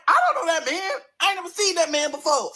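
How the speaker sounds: high-pitched, incredulous denial; defensive protest. Retold or imitated. Girlfriend denying she knows the customer, defensive.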